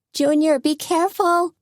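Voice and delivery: very high anxious call; worried cry. A narrator's reading. Tony calling to Junior from the sidelines, high anxious cry. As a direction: cry out in worry. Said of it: "cried Tony".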